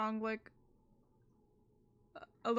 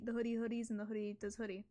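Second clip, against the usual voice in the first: whispering; 3 semitones lower, 3 dB quieter, much faster than usual. Pretend. Silly hoodie babble.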